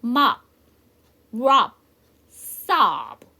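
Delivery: mop, rub, sob each loud and separate; pronunciation model. Shown as a demonstration. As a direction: model mop, rub, sob as isolated, emphatic words for learners to repeat. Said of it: "저랑 같이 한번 연습해 보도록". (mop, rub, sob을 따라 하도록 크게 또박또박)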